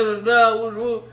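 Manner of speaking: ordinary delivery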